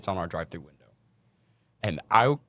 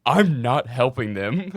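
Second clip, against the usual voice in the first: slow, louder deadpan refusal; flat refusal; 4 semitones higher, 7 dB louder, much slower than usual. Retold or imitated. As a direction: say it dead slow, utterly done. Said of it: "she goes".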